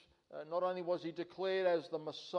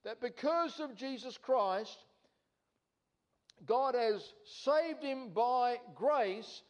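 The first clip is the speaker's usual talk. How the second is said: higher and louder, preaching at full force; fervent preaching; 4 semitones higher, 3 dB louder than usual. Acted. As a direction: drive each claim home from the pulpit.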